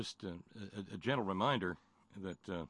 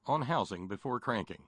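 ordinary delivery

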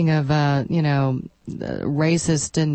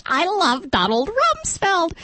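mocking, very high squeaky voice; screechy high pitch; 11 semitones higher, slower than usual. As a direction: parody her in a piercing little squeak. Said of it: "that same little high pitch".

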